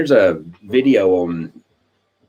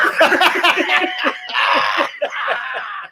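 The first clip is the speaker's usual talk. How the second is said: real big laugh. Real, not acted. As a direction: laugh hard at your own punchline. After telling the punchline.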